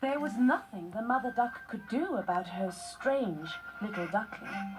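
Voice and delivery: ordinary delivery, nothing marked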